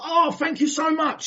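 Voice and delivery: high-pitched delighted gratitude; gushing thanks. Retold or imitated. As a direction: gush like a thrilled recipient. Voices friends delighted with the gift.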